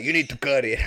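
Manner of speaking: imitating dad's Cheech-like accent; light comic accent with laughter after; mock accent. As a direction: deliver the catchphrase in a put-on accent. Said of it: "he's saying it in an accent".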